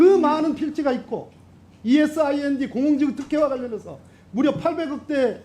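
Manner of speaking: high, heated oratory; impassioned accusation. Real, not acted. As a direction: build the charge with pitch held high.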